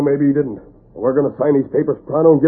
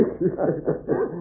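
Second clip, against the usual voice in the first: sly gloating laugh; gloating chuckle; 7 semitones higher, 8 dB quieter than usual. Acted. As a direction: chuckle over the swindle. Crooks gloat over their swindle.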